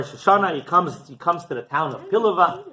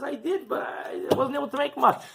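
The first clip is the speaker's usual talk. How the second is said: meek poor man; 12 semitones higher, 3 dB quieter than usual. Acted. Poor man's meek answer to Baron.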